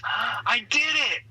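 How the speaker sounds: put-on high, slow, proud kid voice; proud little boy. Boy looks up at mom after zipping his jacket, high proud kid voice. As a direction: beam it up at mom like a small boy. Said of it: "looks up at Mom and goes".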